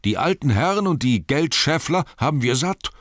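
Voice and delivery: shouting crowd. Acted. Townsfolk near the Master shout back, loud demand. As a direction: shout him down. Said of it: "riefen die".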